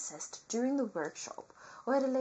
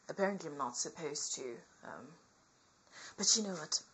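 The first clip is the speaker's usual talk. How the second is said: ordinary delivery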